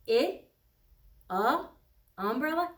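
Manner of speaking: questioning "I?" against "Uh?" then "Umbrella?"; comparing sounds. Shown as a demonstration. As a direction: set the short "I" against the "Uh" vowel. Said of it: "Does that sound the same as I?".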